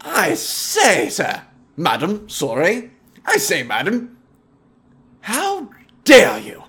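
fake-posh indignation; outraged snob. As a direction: huff like an affronted gentleman. Snob confronts madam.

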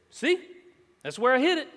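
put-on high, loud, excited little kid; imitating a little boy's high call. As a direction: pipe up loudly like a proud small boy. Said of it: "he looks and he says".

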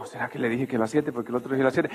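muttered aside. Retold or imitated. Caller muttering her own worry.

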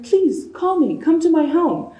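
high-pitched imitated plea; eager pleading. Imitating a stranger inviting a student home, high eager pleading.

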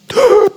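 gulping gasp after surfacing; big gasp for air. Shown as a demonstration. Surfacing from forty feet underwater, a huge gasp for air. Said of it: "I come out and I'm like".

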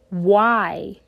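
ordinary talk, nothing marked

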